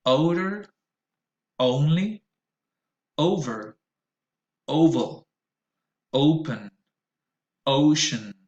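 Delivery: put-on "Oat", "old", "ocean" said slowly with a clear rounded O diphthong; model words, repeat after me. Shown as a demonstration. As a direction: read each word slowly for students to repeat. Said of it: "Repeat after me".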